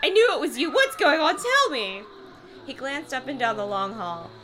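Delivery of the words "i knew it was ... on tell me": high, loud rush of demands; gushing outpour. Acted. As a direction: pour it all out in an overacted burst. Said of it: "the words poured out like a gushing waterfall".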